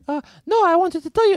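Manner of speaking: very high squeaky little vampire; tiny bat voice. Acted. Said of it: "Victor's like".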